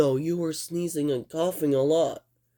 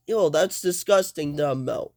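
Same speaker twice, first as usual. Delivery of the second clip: ordinary delivery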